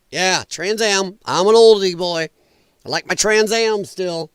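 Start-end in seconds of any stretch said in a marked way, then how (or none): none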